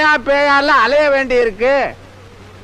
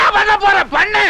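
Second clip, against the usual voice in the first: angry challenge; 5 semitones higher than usual. Acted. Quarrel over sambar, goading nephew.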